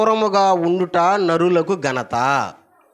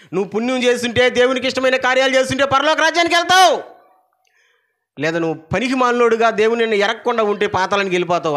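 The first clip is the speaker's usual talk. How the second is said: raised, quick preacher's warning; fiery preaching; 6 semitones higher, faster than usual. Acted. Preacher warning the congregation, in a fast raised preaching voice. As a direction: drive the heaven-or-hell choice home fast. Preacher warns of heaven and hell.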